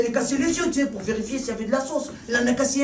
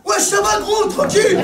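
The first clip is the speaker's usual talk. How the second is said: street greeting; 11 semitones higher, 6 dB louder, slower than usual. Acted. Greeting a limping guy from the neighbourhood.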